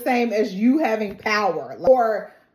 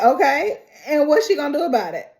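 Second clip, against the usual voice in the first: louder, higher, rushed outrage; indignant; 6 semitones higher, 4 dB louder, faster than usual. Retold or imitated. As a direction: demand an answer fast. Daisy indignant, voiced in retelling.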